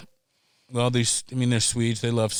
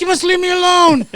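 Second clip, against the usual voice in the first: much higher, much louder cry; mock yell; 8 dB louder than usual. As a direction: yell it while fleeing the cops. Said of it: "I'm like".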